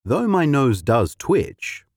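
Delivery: usual speaking voice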